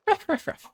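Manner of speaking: playful high-pitched ruff ruffs like a small dog; squeaky mock dog barking; high dog barks. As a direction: yap it out like a puppy. Mimicking a dog bark.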